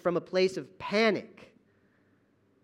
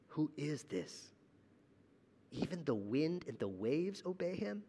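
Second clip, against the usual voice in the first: hushed awe; 10 dB quieter than usual. Retold or imitated. Terrified disciples in awe.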